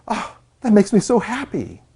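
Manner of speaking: gasp, then high-pitched mock delight; mock happy. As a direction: imitate someone overjoyed, pitch leaping up. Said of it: "happy, sad".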